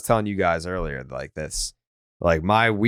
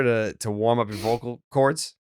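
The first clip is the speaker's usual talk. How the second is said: ordinary talk, nothing marked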